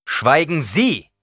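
laut und langsam befohlen; scharfer Befehl. A narrator's reading. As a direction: schneidend zum Schweigen bringen. Paul bringt sie zum Schweigen. (Paul befiehlt der Greisin laut)

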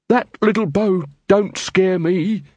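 slow, lightly voiced defiant quartermaster; quartermaster's voice. Quartermaster defying Nobby's crossbow, slow and unbothered. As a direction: drag it out, unimpressed.

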